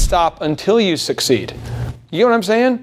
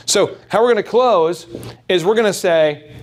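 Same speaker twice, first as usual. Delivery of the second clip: normal talking voice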